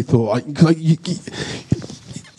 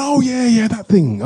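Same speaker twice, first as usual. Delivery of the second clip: high-pitched retold realisation; acted sudden recognition; 11 semitones higher than usual. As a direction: act out suddenly remembering it. Retelling his sudden recognition on phone.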